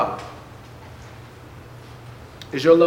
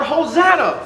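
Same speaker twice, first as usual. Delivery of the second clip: higher, raised-voice acclaim; excited crying out; 9 semitones higher, 5 dB louder than usual. Retold or imitated. Crowd going out to meet Jesus, crying out in excitement. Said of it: "crying out".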